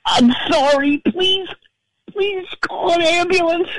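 very high, strained begging; squeaky desperate plea; desperate apology. Retold or imitated. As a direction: cry out for an ambulance in a pinched high voice. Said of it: "And he goes".

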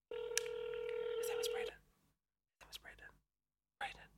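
unvoiced whisper to herself. Real, not acted. Host whispering the caller's name to herself before dialing.

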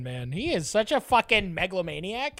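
ordinary delivery

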